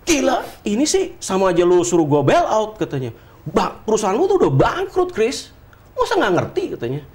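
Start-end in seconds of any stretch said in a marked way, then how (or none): 0.0-2.8 high-pitched, incredulous mock outburst; imitating friend's outburst. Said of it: "Terus dia bilang begini". 3.5-6.6 imitating friend's outburst. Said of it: "Dia loncat dari kursinya".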